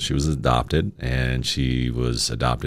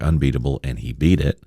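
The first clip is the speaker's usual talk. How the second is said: ordinary talk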